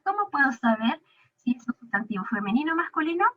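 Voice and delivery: usual speaking voice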